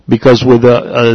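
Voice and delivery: ordinary talk, nothing marked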